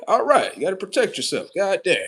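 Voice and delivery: ordinary talk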